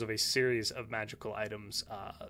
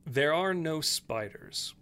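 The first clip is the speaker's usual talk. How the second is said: ordinary talk, nothing marked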